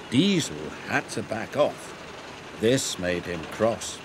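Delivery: plain reading voice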